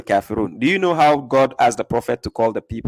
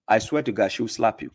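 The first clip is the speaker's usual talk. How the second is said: ordinary talk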